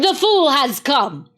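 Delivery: loud, slow triumphant cry; gloating cry. Acted. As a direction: crow it out over the fool. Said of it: "she cried".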